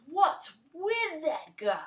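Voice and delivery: high, slow, mocking put-on voice; sneering customer. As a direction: drag it out with scorn. Rude customer sneering at Kevin.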